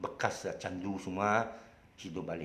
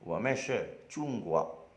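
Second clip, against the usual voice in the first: emperor in Mandarin; slower than usual. Retold or imitated. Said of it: "Emperor dia cakap".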